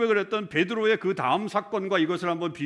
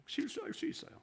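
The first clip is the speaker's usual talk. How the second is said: soft emphasis; 10 semitones higher, 14 dB quieter than usual. Real, not acted. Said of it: "repeated for emphasis".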